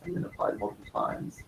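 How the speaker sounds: very low faint voice; trailing off almost inaudibly; barely audible. Real, not acted. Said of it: "your voice is very low only".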